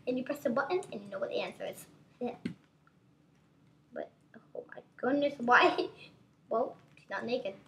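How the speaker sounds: usual speaking voice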